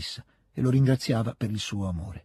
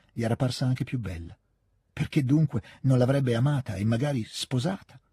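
usual speaking voice

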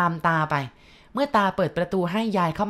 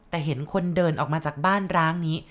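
plain reading voice

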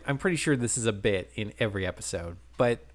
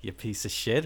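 sexy voice; 4 semitones lower, 6 dB quieter than usual. Retold or imitated. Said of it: "she's repeating it in a sexy voice".

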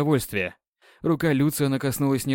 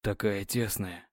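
hoarse voice; 5 semitones lower, 9 dB quieter, slower than usual. Acted. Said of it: "Его голос охрип".

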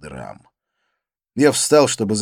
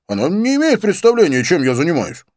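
high, louder self-justifying protest; defensive; 10 semitones higher, 7 dB louder than usual. A narrator's reading. Accused boyfriend excusing himself to a friend, defensively. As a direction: insist on it, starting high and easing down. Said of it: "оправдывался он".

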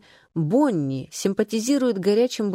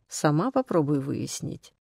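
ordinary delivery, nothing marked

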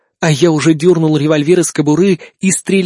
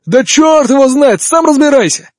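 exasperated shout; 8 semitones higher than usual. Acted. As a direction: snap back in frustration. Frustrated soldier in battle.

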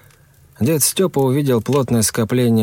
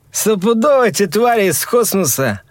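put-on drunk slurring; 11 semitones higher than usual. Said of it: "заплетающимся языком каждое слово".

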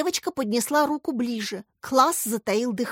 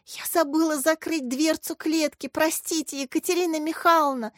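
higher-pitched anxious apology; apologetic; 6 semitones higher than usual. A narrator's reading. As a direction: plead for forgiveness in a high voice. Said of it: "извинилась Варя". (виновато, высоким голосом)

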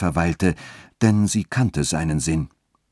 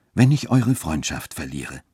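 ordinary delivery, nothing marked

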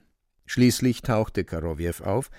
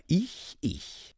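eager cat; 7 semitones higher, 6 dB quieter than usual. A narrator's reading. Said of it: "hauchte der Kater".

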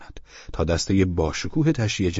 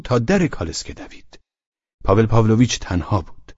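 ordinary delivery

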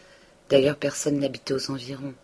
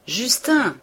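raised higher call across the shop; 8 semitones higher, 5 dB louder than usual. A narrator's reading. The apothecary calling his apprentice Justin, raised. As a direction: shout for the boy. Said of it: "cria l'apothicaire".

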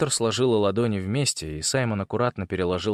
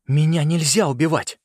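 raised-pitch, insistent plea; higher, pressing appeal; urgent protest; 5 semitones higher, 4 dB louder than usual. A narrator's reading. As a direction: protest for your life. Boy pleads for his life.

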